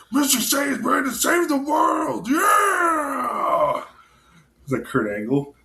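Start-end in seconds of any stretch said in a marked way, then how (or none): 0.1-3.8 boastful hero shout. Retold or imitated. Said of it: "he says".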